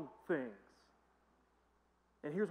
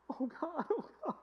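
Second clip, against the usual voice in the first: high, wailing mock sob; a hammed-up wail of grief; mock crying; 11 semitones higher than usual. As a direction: imitate an old lady sobbing. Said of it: "She's just crying".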